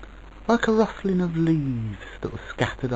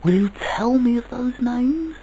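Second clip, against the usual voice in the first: put-on high, louder child voice; child asking; 14 semitones higher, 6 dB louder than usual. A narrator's reading. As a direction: ask brightly as a curious child. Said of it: "asked the child of dreams".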